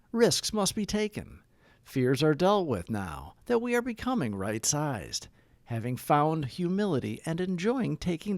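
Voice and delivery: hushed devotional reading; soft meditative reading. Acted. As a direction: read it gently like an opening meditation. Soft opening reading before announcements.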